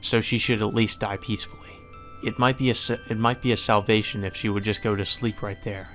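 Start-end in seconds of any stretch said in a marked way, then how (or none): none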